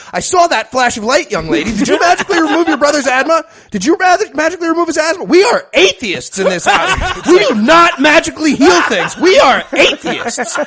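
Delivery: high, loud hammed-up angry mom shrieking; mad mom. Mother shrieks at her daughter over the healing, hammed-up fury. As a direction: screech it like a furious mother caught her kid. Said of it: "still mad".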